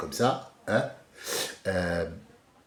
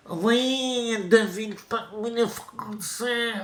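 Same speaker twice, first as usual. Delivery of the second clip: high, drawn-out mocking voice; mocking politician impression; 11 semitones higher, slower than usual. Speaker mimics a politician talking about the French, mocking. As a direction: stretch the words high to ridicule him. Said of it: "on t'a entendu ta voix?".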